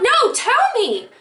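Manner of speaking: high, drawn-out insistent plea; retold impatient demand, slow and high; freaking out. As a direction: stretch it out high, desperate to know. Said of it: "and I was freaking out".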